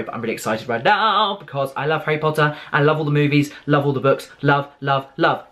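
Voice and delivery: normal talking voice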